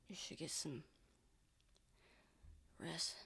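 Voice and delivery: very soft, trailing into a whisper; fading whisper. Acted. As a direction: say it as if drifting off. Exhausted character whispers advice.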